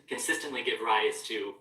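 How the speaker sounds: ordinary delivery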